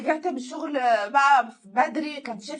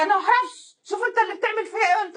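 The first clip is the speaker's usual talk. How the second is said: higher, loud, hurried protest; mock indignant wife; 6 semitones higher, 3 dB louder, much faster than usual. Wife blaming her husband, mock indignant protest. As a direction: throw the blame back, imitating the wife. Retold wife's defensive accusation.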